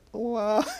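high, startled "What?"; surprised what. Retold or imitated. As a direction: jump up high in disbelief. Teller recalls her own astonishment.

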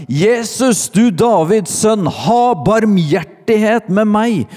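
high, loud cry for mercy; calling out. A narrator's reading. Blind beggar to Jesus passing by, calling out loudly. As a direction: shout up the plea at full pitch. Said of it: "satte han i å rope".

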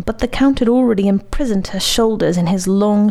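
usual speaking voice

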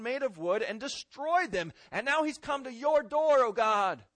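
high, fervent plea to God; preacher voicing a king's urgent prayer; impassioned prayer. Preacher voices Hezekiah's prayer.

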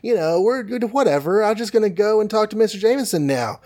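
overly friendly Peter. Retold or imitated. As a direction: play it too cheerful to hide the hurt. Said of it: "Peter is totally friendly".

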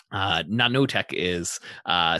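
usual speaking voice

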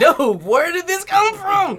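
high, slow mock bewilderment; bewildered. Groom mocking his surprise at sudden tears, high and slow. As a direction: stretch it out high and baffled.

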